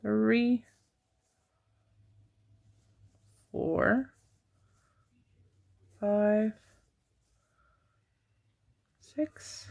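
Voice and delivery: counting with a marker in the mouth; stitch marker in mouth. Real, not acted. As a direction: mouth half-blocked, words slightly muffled. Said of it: "I have a stitch marker in my mouth".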